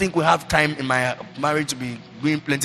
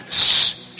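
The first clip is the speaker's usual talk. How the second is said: unvoiced hushing shh; father's hush; 6 dB louder than usual. Father cutting off his returning son with a hush. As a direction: hush the son before he speaks. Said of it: "As he was trying to say".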